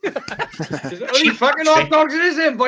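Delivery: put-on Welsh accent. Friend quoting a Twin Town line in a laughing Welsh accent. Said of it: "You remind me of something off Twin Town".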